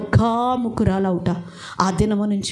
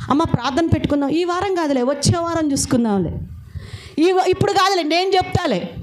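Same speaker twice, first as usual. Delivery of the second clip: dismissive brush-off; 7 semitones higher than usual. Retold or imitated. Imitating dismissive church members.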